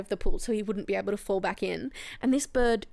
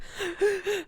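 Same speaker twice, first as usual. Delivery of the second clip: sharp high gasp of shock; retold gasp; 9 semitones higher, 4 dB quieter than usual. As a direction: gasp as if startled by the crash. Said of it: "And I was like".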